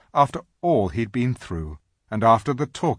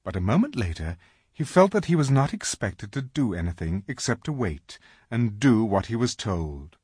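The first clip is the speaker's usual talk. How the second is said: plain reading voice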